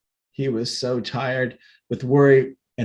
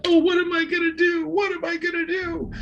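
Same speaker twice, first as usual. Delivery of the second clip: very high, panicked wail; frightened wail. A narrator's reading. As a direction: wail it out in terror. Wilbur panics.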